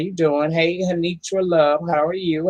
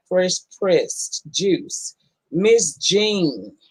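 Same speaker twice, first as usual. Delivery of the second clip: ordinary talk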